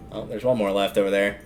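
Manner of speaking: usual speaking voice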